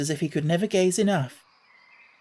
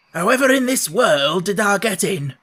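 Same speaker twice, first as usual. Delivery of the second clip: raised, bursting gardener's question; curious old man; 4 semitones higher, 5 dB louder than usual. Acted. Ben demanding how Mary got in, loud and curious. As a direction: blurt it out, dying to know. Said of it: "overpowered by curiosity".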